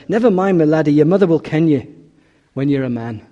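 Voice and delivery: put-on mother comforting her boy in Scottish; Scottish accent. Mother comforts her hurt little son, Scottish accent. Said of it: "in the Scottish accent".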